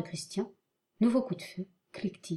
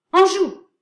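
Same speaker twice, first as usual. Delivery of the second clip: high, loud battle orders; shouting commands; 11 semitones higher, 14 dB louder than usual. Acted. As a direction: bark orders to the troops. Officer commanding troops.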